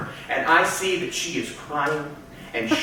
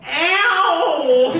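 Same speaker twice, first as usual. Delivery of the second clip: high, loud feeble yelp of pain; a pathetic yelp instead of a yell; 11 semitones higher, 4 dB louder than usual. Retold or imitated. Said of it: "all that comes out is".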